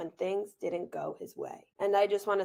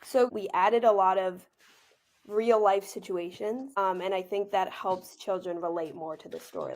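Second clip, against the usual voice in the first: usual speaking voice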